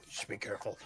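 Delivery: hushed, ominous warning; quiet warning. Acted. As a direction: breathe it from behind him.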